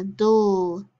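DULL as a drawn-out "dul" syllable; isolated syllable sound. Shown as a demonstration. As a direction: sound out "dull" as the -dle ending. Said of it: "Final syllable".

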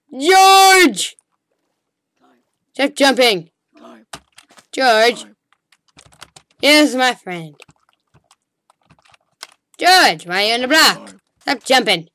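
loud call across the game. Real, not acted. Player yelling at friend George to stop jumping. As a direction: shout his name to stop him.

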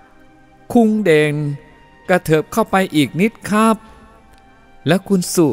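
put-on high, drawn-out Chinese-accented coaxing; Chinese-accented photographer. Helper tells Den and Su to move closer, high accented voice. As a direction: nudge them closer in a Thai-Chinese accent. Photographer directs bride and groom.

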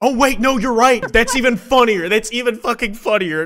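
loud, high, excited realization; delighted outburst. Real, not acted. Streamer realizes the joke is even funnier, delighted outburst.

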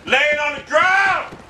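high, drawn-out angry yell. Real, not acted. Gamer cursing an enemy lying down, angry yell. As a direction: drag it out in exasperation.

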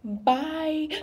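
ordinary delivery

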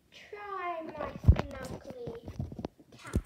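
loud, drawn-out witch voice. A narrator's reading. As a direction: stretch it out slowly as a storybook witch. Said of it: "The witch said".

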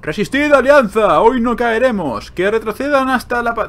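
high, loud heroic war cry; rally the troops to hold out; rallying commander. Acted. Reads king's battle speech aloud.

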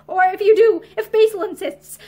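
loud, forceful insistence; insist, voice lifted and hard; harsh voice. A narrator's reading. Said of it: "Pansy said in a harsh voice".

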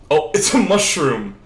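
ordinary talk, nothing marked

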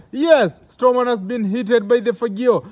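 ordinary talk, nothing marked

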